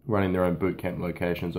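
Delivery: normal talking voice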